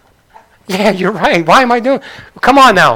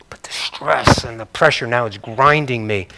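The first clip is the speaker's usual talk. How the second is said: whisper; 7 semitones lower, 7 dB quieter than usual. Real, not acted. Pastor confessing pressure, hushed.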